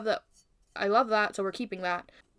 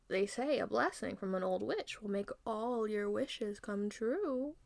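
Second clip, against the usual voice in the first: slow, soft, eerie queen; quiet, drawn-out old witch voice; distant witch; 7 dB quieter, slower than usual. Acted. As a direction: murmur it slowly like a spell. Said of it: "in the distance, we can almost hear the queen".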